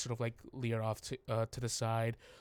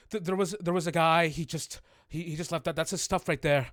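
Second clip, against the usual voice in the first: fast, higher, stumbling excuse; flustered explaining; 5 semitones higher, 6 dB louder, faster than usual. Acted. Stellar explaining the guy to armed guards, flustered. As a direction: gabble it out nervously. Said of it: "Stellar goes".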